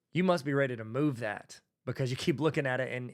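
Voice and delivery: ordinary talk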